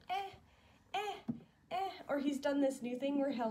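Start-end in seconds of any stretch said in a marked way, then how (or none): 0.1-1.9 put-on high baby grunts; imitating baby babble. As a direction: echo his little eh sounds, much higher. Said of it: "he'll just be like".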